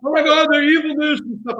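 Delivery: high, loud mock gasp of horror; mock outrage. Co-host mocking how the US would react, high and loud mock horror. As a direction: ham up a scandalised American reaction.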